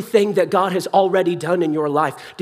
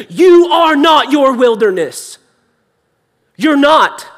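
louder, slow, raised pulpit proclamation; emphatic declaration; 8 semitones higher, 9 dB louder, slower than usual. Real, not acted. Preacher tells somebody they are not their wilderness, emphatic declaration. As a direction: proclaim it forcefully, stretching the words.

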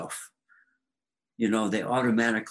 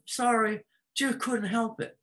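high scorpion voice; 11 semitones higher than usual. Retold or imitated. Scorpion apologizes.

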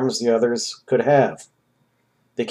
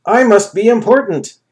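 excited boast; 9 semitones higher, 5 dB louder than usual. A narrator's reading. Raving prophet to witnesses.

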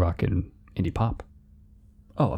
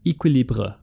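Equilibre said the French way, eh-kee-LEE-bruh; careful French Equilibre; French pronunciation. Shown as a demonstration. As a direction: try Equilibre with a French accent. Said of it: "Hopefully my French accents".

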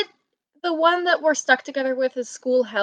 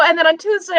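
usual speaking voice

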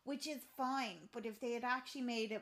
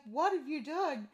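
mock outrage; 6 semitones higher, 8 dB louder than usual. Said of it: "they're like".

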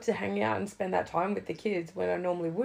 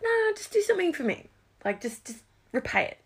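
higher and louder, an airy brush-off; mock breezy refusal; 10 semitones higher, 6 dB louder than usual. Turning down a friend's cash, mock casual. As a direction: toss off the cash offer lightly.

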